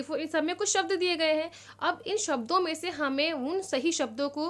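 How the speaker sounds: ordinary delivery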